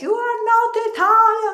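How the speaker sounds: high, loud sing-song mock accusation; mocking outraged Italians. Italians scolding her for mayo on pizza, high sing-song mock. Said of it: "And they're like".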